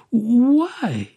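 high, loud, disbelieving question; bewildered stammer. A narrator's reading. Young woman questions why she is wanted, stammering. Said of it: "stammered the young woman".

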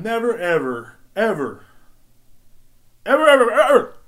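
emphatic evers rising to a high shout; building outburst. Retold or imitated. Ranting about what set him off, escalating to a shout. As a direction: build each "ever" into a loud, high yell.

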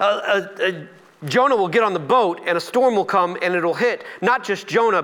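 ordinary delivery

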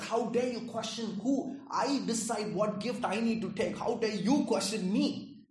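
hammed-up angry deity demanding how dare you; indignant God. Preacher voices an angry God he rejects, mock outrage. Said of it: "He doesn't come like that".

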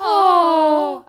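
loud, high drawn-out oh; mock wail of disappointment. Host tries the story's lament, loud mock wail.

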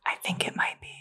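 hushed stage whisper; breathy whisper for comic effect; whisper yelling. Real, not acted. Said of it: "Why are we whispering?".

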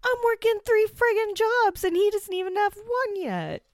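very high, loud ranting mockery of Alex; mock yelling. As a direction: shriek the complaint like a mocked teen. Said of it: "She's like".

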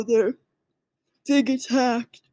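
talking through a real yawn. Real, not acted. Recapper yawns while telling the episode, yawning. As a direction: let a yawn stretch the words. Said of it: "Sorry, I was yawning".

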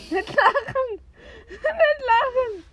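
laughing while begging don't laugh; high and loud, fighting back laughter; trying not to laugh. Real, not acted. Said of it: "nicht lachen jetzt".

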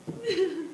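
a sudden high laugh, then quiet; laughing at his daughter marching off; high burst of laughter. Real, not acted. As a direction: let a squeak of laughter escape. Laughs at the story's punchline.

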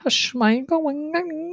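high, drawn-out mock Dutch gibberish; comic Dutch nonsense voice. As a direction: sing out silly fake Dutch, slowly. Joke Dutch accent game.

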